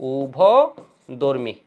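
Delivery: উভধর্মী drawn out very slowly, each syllable stretched; উভধর্মী very slow and deliberate, as if dictating; slow emphatic term. Shown as a demonstration. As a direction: spell out উভধর্মী slowly so students catch it. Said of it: "অ্যামফোটেরিক মানে হচ্ছে উভধর্মী".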